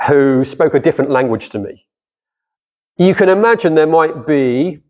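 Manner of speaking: ordinary talk, nothing marked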